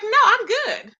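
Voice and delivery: high, loud brush-off; emphatic refusal. Retold or imitated. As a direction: turn it down loudly and sassily. Retelling her refusal of invitation.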